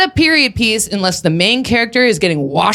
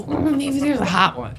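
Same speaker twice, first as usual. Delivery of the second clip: mock hopeful murmur; 4 semitones higher, 6 dB quieter than usual. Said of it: "You can't go".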